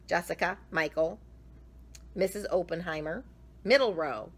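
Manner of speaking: plain reading voice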